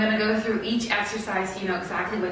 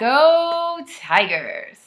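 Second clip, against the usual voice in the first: slow drawn-out cheer; 4 semitones higher, 5 dB louder, much slower than usual. Real, not acted. Cheering for rival team.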